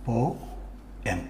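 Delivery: పో, pause, then ఎం; syllable by syllable. Shown as a demonstration. Teacher showing how to divide a word, syllable by syllable. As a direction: separate పో and ఎం clearly. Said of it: "ఎలా విడగొడుతున్నాం".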